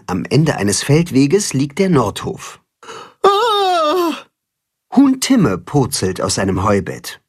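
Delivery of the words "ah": erschrockener Schrei. Acted. Huhn purzelt aus dem Heubett.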